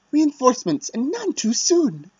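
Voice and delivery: put-on high, loud cocky young noble; high-pitched Stewie Griffin. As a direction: crow it like a smug upstart. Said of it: "a high-pitched Stewie Griffin voice for Argath".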